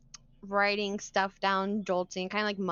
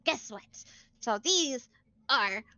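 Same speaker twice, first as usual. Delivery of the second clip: higher, excited bookish woman; eager NPC voice; 6 semitones higher than usual. Acted. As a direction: show off the crystals eagerly.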